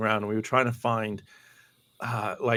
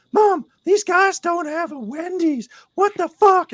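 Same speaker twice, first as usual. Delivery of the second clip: slow, very high, loud disbelief; outraged fan impression; 7 dB louder, slower than usual. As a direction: wail to mom in a squeaky, scandalised voice. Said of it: "and he's like".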